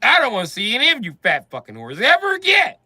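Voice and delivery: shouting. Retold or imitated. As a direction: yell it as a farewell. Final outburst.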